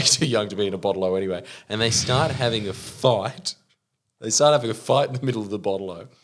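ordinary delivery

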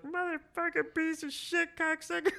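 high, drawn-out mock cursing; hammed-up livid swearing in a put-on voice. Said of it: "he just goes livid".

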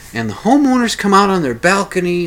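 ordinary delivery

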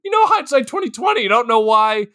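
high, light voice admitting a mistake; singsong high shrug of a voice; mock sheepish admission. Mocking the group admitting the bad choice, high and sheepish.

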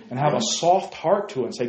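ordinary talk

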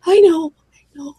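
ordinary delivery

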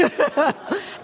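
short, high laugh; a quick amused laugh. Real, not acted. Preacher laughing at her own line.

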